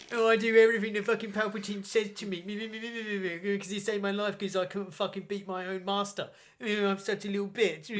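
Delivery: high, whiny self-pitying send-up; hammed-up pathetic moaning; mocking Vader. Admirals taking the piss out of Vader, whiny mockery. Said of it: "taking the piss out of Vader".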